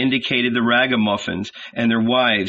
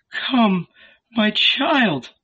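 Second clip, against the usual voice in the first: pleading; 10 semitones higher, much slower than usual. A narrator's reading. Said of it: "Mary repeated".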